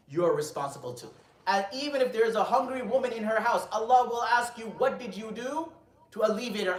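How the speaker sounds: forceful, loud warning to a ruler; stern admonition. Retold or imitated. Scholar warns the caliph of his responsibility, stern admonition.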